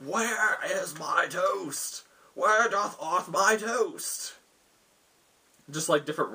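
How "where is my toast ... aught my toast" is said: high, drawn-out mad lord's rant; rambling man. Acted. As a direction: ramble loftily in a put-on high voice, very slowly. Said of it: "they hear rambling of this man".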